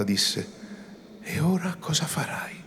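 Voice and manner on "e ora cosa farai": hushed, higher whisper as if spellbound; whispered question. A narrator's reading. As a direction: breathe it out, stunned. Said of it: "come incantata". (domanda sussurrata, come incantata)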